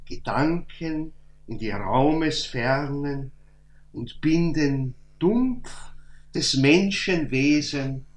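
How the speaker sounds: slow, measured recital with weighty stressed words; solemn verse recitation. Acted. Lecturer reciting the weekly verse to listeners, solemn slow recitation. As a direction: recite the verse slowly, pausing after each phrase.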